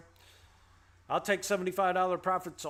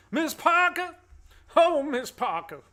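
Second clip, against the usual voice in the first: much higher, loud swooning call; hammed-up Bernie Mac call, much higher and louder; Bernie Mac impression; 11 semitones higher, 8 dB louder than usual. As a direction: call after her like a lovestruck preacher. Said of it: "Man, I love Bernie Mac".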